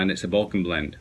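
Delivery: ordinary talk, nothing marked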